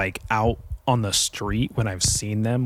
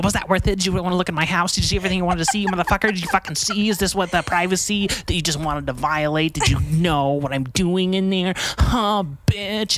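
higher, jeering gloat; mock gloating; 6 semitones higher than usual. Gloating over the fallen neighbor, high and jeering. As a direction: rub it in like a cartoon villain. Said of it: "I'm gonna go".

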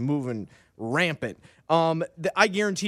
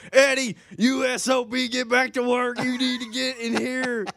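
mock angry boss; 8 semitones higher than usual. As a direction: yell like an exasperated boss. Impersonating angry boss yelling.

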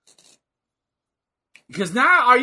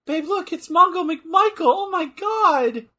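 higher excited calling out; 9 semitones higher than usual. Real, not acted. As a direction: call out in delight. Excited spotting Mongo.

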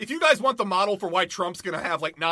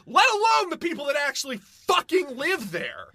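furious, near tears, shouting; angry outburst; 9 semitones higher than usual. Real, not acted. Ranting at the church's priorities, crying out angrily. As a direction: voice cracking into a shout.

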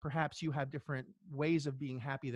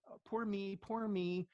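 mock whiny victim; 5 semitones higher, slower than usual. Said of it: "like a drowning person".

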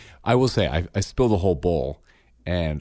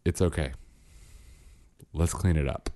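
calm reassurance; 3 semitones lower, 6 dB quieter than usual. Retold or imitated. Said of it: "took a deep breath".

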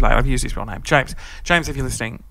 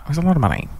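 ordinary talk

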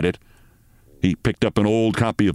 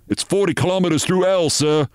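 higher urgent soldier's report; worried sergeant; 8 semitones higher than usual. Acted. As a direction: report it tensely to the captain. Sergeant voiced in story.

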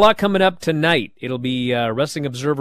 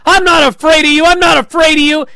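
much higher, loud defiant scream; 12 semitones higher, 10 dB louder than usual. Retold or imitated. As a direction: scream it right in her face. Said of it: "she starts screaming at Ava".

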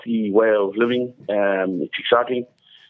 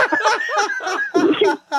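high helpless laugh; can't stop laughing; real laughing fit, high-pitched; real high laughter. Real, not acted. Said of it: "was laughing".

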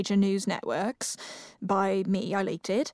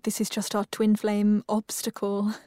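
normal talking voice

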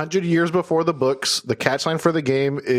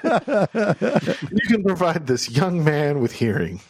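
very slow mock charity appeal voice; milk it like a donation ad; hammed-up charity appeal; charity ad parody; 2 semitones higher, much slower than usual.

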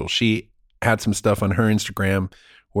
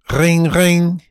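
phone ring imitation; 4 semitones higher, 5 dB louder than usual. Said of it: "this is me pre-ninety-five".

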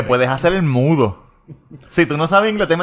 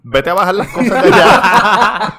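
mock boss order; 8 semitones higher, slower than usual. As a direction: play the bossy manager, laughing through it. Mocking the boss's order, laughing.

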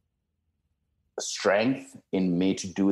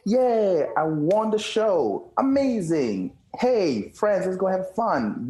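excited; 11 semitones higher, slower than usual. Pretend. Mocking the good times, celebrating.

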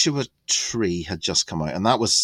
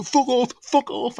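protest; 3 dB louder than usual. Retold or imitated. Mate refusing, being pushed.

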